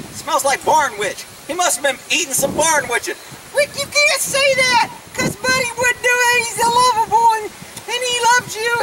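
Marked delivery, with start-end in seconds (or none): 3.5-8.7 high-pitched put-on pleading voice; silly defender voice. Someone scolding Rick for the joke, high put-on voice.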